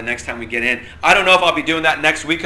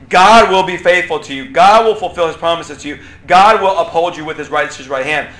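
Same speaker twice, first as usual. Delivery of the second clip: louder, like someone else passing on a promise; secondhand reassurance; 2 semitones higher, 8 dB louder than usual. Retold or imitated. Teacher voicing a friend telling you about God, louder and at a distance. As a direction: announce it as another person's report about God. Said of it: "is like someone saying to you".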